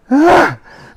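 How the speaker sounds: loud, high wailing sigh; dramatic crying sigh. Retold or imitated. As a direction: heave a big, theatrical sob-sigh. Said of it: "Like this".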